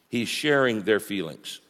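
ordinary delivery

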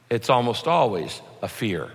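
ordinary talk, nothing marked

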